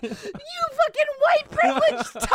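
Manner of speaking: very high, loud comic tirade; mock outraged yell. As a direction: scream fake abuse through your laughter.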